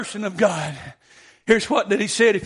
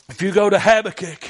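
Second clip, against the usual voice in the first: ordinary talk, nothing marked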